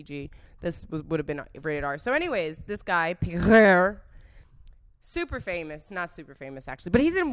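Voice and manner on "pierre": Pierre drawn out loudly with a rolled French R; French-style name. Shown as a demonstration. Telling listeners about this guy Pierre, exaggerated French pronunciation. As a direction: growl and stretch the R in Pierre.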